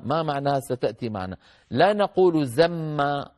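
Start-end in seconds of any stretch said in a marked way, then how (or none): 2.5-3.3 زما with a long doubled M and no N, zam-maa; wrongly merged noon. Shown as a demonstration. Teacher shows the wrong form, زما with a humming double M. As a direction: drop the N and hold the M in زما. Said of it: "لا ندغم النون في الميم".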